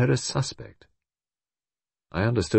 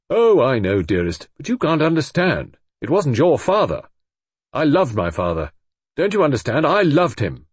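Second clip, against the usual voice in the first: loud, high, emotional pleading; impassioned plea; 6 semitones higher, 5 dB louder than usual. A narrator's reading. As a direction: pour out love for his father. Roger pleads with his wife.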